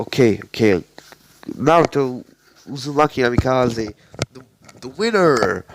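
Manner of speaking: a proctor declaring the winner to the arena, stumbling; flustered announcement. Acted. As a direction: announce the winner while still rattled.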